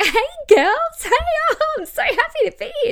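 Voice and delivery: high, loud, gushing squeal; hammed-up bubbly greeting, rushing with excitement; giggly fake excitement. Mocking girls greeting a women's meeting, giggly and gushing. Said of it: "the girls kind of get up and".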